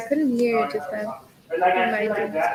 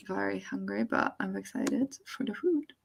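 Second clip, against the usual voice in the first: whisper; 9 dB quieter than usual. Real, not acted. Hungry, waiting for dinner.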